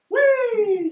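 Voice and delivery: schrilles, sehr hohes Juchzen; screaming ball. A narrator's reading. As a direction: shriek it high and loud like a flying ball. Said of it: "screams its trajectory".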